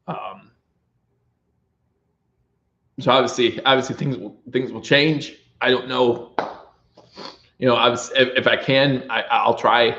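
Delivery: ordinary talk, nothing marked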